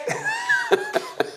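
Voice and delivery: squealing real laugh; high-pitched laugh. Real, not acted. Laughing at cohost teasing, high squeal.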